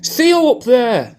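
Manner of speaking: hammed-up call, stretched out; mock shout up. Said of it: "could be shouting up, going".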